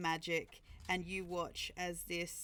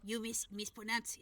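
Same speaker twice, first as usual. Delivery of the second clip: ordinary delivery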